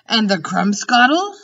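a giant naming strange beasts; BFG. Acted. The giant quizzing Sophie, loud and pleased.

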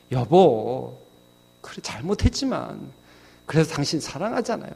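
soft, coaxing appeal; calm an angry husband softly; gentle pleading wife. Acted. Mother gently pleading with angry father. (남편을 달래는 아내처럼 부드럽고 조용하게)

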